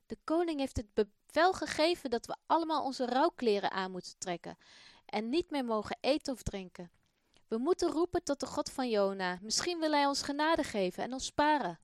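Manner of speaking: a man proclaiming the king's decree; urgent announcement. Acted. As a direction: announce the king's order to all.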